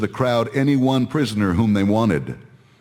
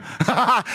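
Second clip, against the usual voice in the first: short, high jeering cackle; mocking laugh; 10 semitones higher than usual. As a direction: laugh in scorn at a prisoner. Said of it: "mocking him".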